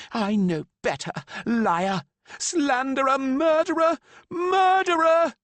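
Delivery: high, loud, building scream; grieving mother shrieking at Lan; screaming accusations. A narrator's reading.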